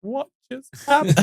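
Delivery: stunned little voice, played for laughs; shell-shocked. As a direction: act dazed after being thrown out. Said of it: "like, shell-shocked".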